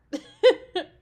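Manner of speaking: loud high giggling burst; burst of laughter. Acted. Girlfriend laughing at the card signed your brat.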